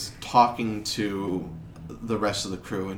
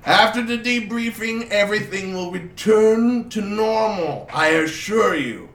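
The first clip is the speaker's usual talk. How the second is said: higher, louder reassuring noble's voice; pompous duke; 7 semitones higher, 5 dB louder than usual. Acted. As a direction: promise calm like a self-important duke. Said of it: "looks around and says".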